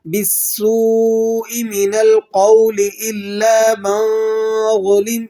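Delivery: slow melodic tajweed chant; slow chanted Arabic verse; Quranic recitation. Shown as a demonstration. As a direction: draw each vowel out in recitation.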